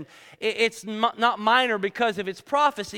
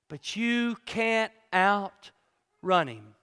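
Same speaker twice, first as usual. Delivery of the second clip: each phrase weighed out, much slower; preacher driving the point home, very slow; slow preaching emphasis; 3 dB louder, much slower than usual. Acted. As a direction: build it up like a pulpit climax. Preaching climax, slow emphatic lines.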